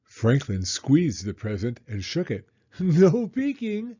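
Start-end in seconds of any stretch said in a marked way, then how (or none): 2.6-4.0 high, drawn-out teasing through a laugh; laughing mock warning, louder and higher. A narrator's reading. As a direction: tease playfully with a laugh in the voice. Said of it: "laughed his mother".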